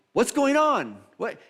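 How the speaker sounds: high, loud call of a blind bystander; yelling out. Retold or imitated. Blind person asking the passing crowd, yelling out. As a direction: call out over a crowd, pitch way up. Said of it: "a blind person yells out".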